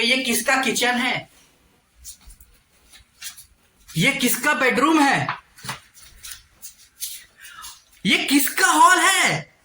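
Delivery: high, drawn-out mock questioning, building; puzzled viewers' voice. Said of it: "वो लोग ये सोच रहे होंगे". (दर्शकों की नकल में खींचा हुआ ऊँचा सवाल)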